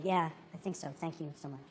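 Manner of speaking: barely voiced, worn out from crying; quiet and drained. Quiet thanks after crying.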